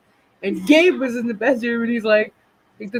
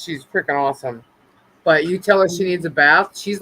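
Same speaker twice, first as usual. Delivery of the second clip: normal talking voice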